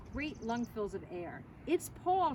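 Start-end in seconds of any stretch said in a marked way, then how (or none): 1.7-2.3 a high excited cry; mildly screamed name. A narrator's reading. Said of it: "she screamed".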